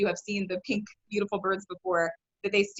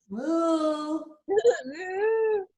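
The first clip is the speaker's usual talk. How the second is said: laughing moo; 6 semitones higher than usual. Pretend. Cow pose again, laughing.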